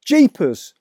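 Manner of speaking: a sharp high gasp of surprise; lightly voiced startled father; surprised exclaim. A narrator's reading. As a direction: blurt it out in amazement. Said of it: "my father said".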